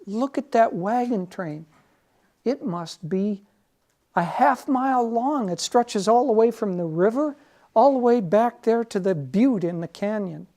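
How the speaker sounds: high, loud, wide-eyed wonder at the screen; amazed regular guy. Acted. Regular guy marveling at the TV wagon train, loud and high. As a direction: marvel at it like a hooked viewer.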